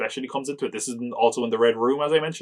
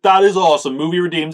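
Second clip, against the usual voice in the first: thrilled; 4 semitones higher, 7 dB louder than usual. Retold or imitated. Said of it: "And I was like".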